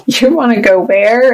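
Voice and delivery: high-pitched mock disbelief, rising; incredulous partner. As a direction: play a partner balking in a money fight. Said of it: "it immediately turns into a fight".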